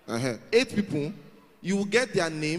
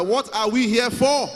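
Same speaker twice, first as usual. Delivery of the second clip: raised, high, slow call to the crowd; loud, drawn-out chant leader; louder rallying call; 5 semitones higher, 5 dB louder, slower than usual. Shown as a demonstration. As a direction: stretch it out loudly to rouse the room. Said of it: "It can still be louder".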